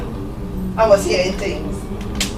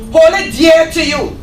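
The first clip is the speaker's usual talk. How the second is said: louder, pressed on HOLD; firm and louder; 7 semitones higher, 5 dB louder than usual. Acted. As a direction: drive it home to the congregation. Repeated, second louder.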